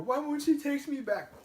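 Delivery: high, drawn-out pleading whine; whiny chode. Acted. Mocking his old self as that type of guy, high whine. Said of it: "That type of guy".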